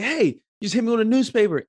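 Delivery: high, indignant complaint; mock yell of an outraged victim; retold angry yell. Man confronts girls who threw a newspaper, retold indignant yell. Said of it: "I'm yelling at this little girl".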